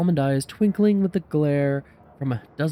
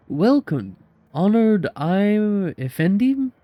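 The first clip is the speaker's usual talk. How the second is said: Turkish vendor; 6 semitones higher, 4 dB louder, slower than usual. Acted. Classic Turkish street vendor greeting.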